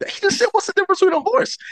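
mock outrage, squeaking up; imitating a confused colleague; baffled coworker. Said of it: "he said".

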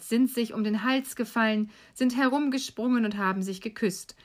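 plain reading voice

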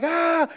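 high, loud cry of alarm; acted panicked "ah"; terrified scream. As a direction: yelp in terror as the lion charges. Gorilla man screams at charging lion.

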